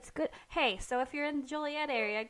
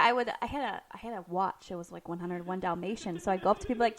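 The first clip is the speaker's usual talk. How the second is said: ordinary delivery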